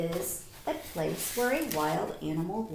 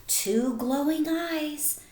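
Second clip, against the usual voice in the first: put-on slow, lilting read to children; storybook read-aloud; 6 semitones higher, slower than usual. A narrator's reading. Teacher reading a bedtime story to the class, lilting and slow. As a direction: savour each line for the class.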